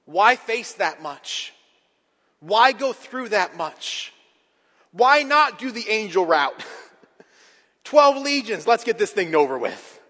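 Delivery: loud, high rhetorical questions, each falling at the end; preaching at full force. Real, not acted. Preacher asking why Jesus faced rejection, forceful preaching. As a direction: drive each why hard, stretched and insistent.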